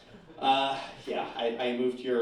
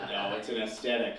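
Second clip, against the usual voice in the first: ordinary talk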